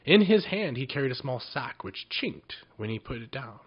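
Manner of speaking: plain reading voice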